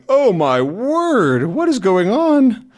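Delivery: high, slow, shocked exclamation; alarmed gasp. Acted. Tintin staring down the cliffs, high alarmed cry. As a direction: gasp down at the water below. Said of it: "looking down over the cliffs, um, down into the water and going".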